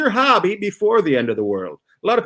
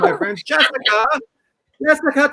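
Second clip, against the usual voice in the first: usual speaking voice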